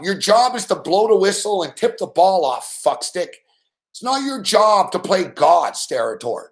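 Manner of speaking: ordinary talk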